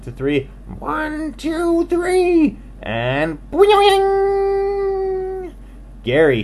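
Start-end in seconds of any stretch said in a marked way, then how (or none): 0.8-2.6 counting in a high squeaky chorus; a crowd of little animals counting high. Acted. Animals count off the jump in a high chorus. 2.8-5.5 a high springy boing; boing effect. Acted. Narrator springs the jump with a high boing.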